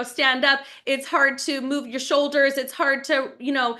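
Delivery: ordinary delivery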